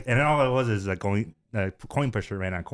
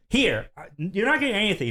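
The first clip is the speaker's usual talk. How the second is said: high mock scolding; exasperated parent's raised voice; retold dad yelling; 10 semitones higher than usual. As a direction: imitate a dad giving up on his kid. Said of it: "yelling at him".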